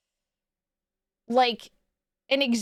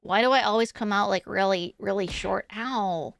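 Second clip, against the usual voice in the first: Ditzy; 2 semitones lower, 3 dB quieter than usual. Pretend. Nerd from the basement greeting the stream, awkward and soft. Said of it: "after I bring out Ditzy Nerd".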